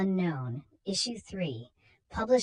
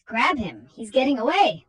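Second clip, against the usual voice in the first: higher, louder alarmed call; urgent shout; 5 semitones higher, 8 dB louder than usual. Acted. Stagehand shouting to security, urgent loud call. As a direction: yell to the others to catch him. Stage hand calls out chase.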